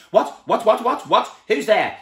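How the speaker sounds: high, loud startled stammering. A narrator's reading. Mister Jelly startled by the worm, loud startled stammer. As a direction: jump and stammer in shock.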